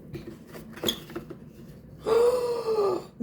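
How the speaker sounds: high excited gasp; sharp thrilled intake of breath. Real, not acted. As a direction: gasp in awe at the unboxing. First sight of the item.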